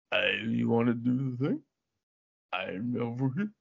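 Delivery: low, slurred, unintelligible mumble; Sylvester Stallone. Acted. Comedian mocking the star's incomprehensible dialogue, low slurred mumble. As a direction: mumble it deep in the mouth, words running together. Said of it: "there's no fucking way I would have understood his dialogue".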